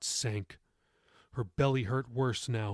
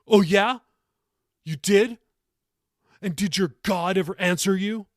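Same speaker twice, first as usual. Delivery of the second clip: loud, high, challenging demand; angry grandson demanding an answer; 7 semitones higher, 8 dB louder than usual. A narrator's reading. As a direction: throw the question at her like a challenge. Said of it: "Jacob demanded".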